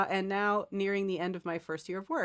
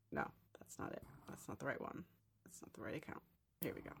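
very soft, barely voiced self-talk; muttering to self; 5 semitones lower, 15 dB quieter, faster than usual. Real, not acted. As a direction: mutter under your breath while searching. Searching phone for the right email.

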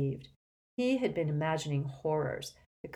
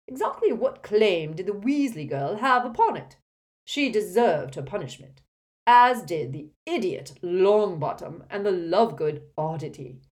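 loud pompous condemnation; imperious scorn, projected; haughty portrait, scornful; 3 semitones higher, 8 dB louder than usual. Acted. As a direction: pass judgment like a disdainful headmaster.

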